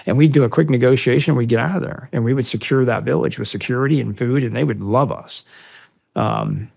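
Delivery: usual speaking voice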